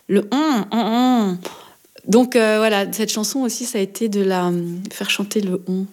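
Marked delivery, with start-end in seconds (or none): 0.1-1.4 "on" drawn out as a sustained French nasal; nasal French vowel. Shown as a demonstration. Singer showing the hard French "on", nasal and held. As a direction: hold the nasal "on" and let it ring. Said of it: "difficile de le faire sonner bien".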